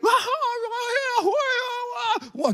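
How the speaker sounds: high mock wailing wah wah; imitated wailing. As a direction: wail like a crowd crying out, hammed-up. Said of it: "kalau teriak-teriak". (tiruan ratapan tinggi)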